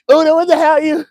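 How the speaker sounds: high-pitched, loud comic wail; mock outburst. Host reacting to her bugging, high mock outburst. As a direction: squawk it in mock disbelief, way up high.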